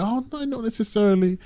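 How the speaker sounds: high, mild mock objection; imitating Star Wars Theory. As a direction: pitch the imitated reply right up. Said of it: "Star Wars Theory was like".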